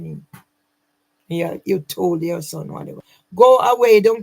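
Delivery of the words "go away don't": loud, sharp order to leave; angry. Real, not acted. To Mimi, loudly ordering her away. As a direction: throw her out with it.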